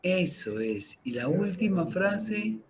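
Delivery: slow, soft meditation voice with long pauses; guided meditation. Acted. Teacher guiding listeners through breathing, calm meditation voice. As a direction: breathe the words out, calm and unhurried.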